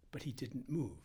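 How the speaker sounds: ordinary delivery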